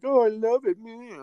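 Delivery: put-on fan enthusiasm; gushing fans. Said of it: "Other people are like".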